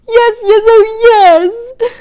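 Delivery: high, crying yes; wife agreeing through tears; frantic, tearful yes. Acted. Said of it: "A frantic, watery-eyed nodding".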